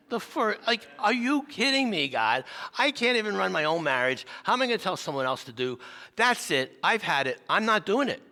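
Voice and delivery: high, loud angry rant; furious protest. Retold or imitated. Angry at God before the counseling appointment, shouting. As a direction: throw it back at God, fed up.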